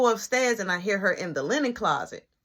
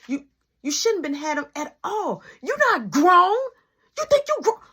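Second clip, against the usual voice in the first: high, loud, scolding mother's voice; the angry mother who won't hear a lie; scolding mom; 9 semitones higher, 3 dB louder than usual. Retold or imitated. Mom scolding over stolen papers.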